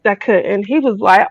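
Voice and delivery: ordinary delivery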